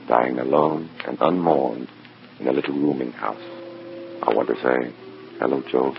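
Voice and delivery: low, measured first-person noir narration; noir story narration. Acted. Agent narrating how he handles the dead soldier's pouch, in a measured noir voice. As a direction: tell it like a tired detective looking back.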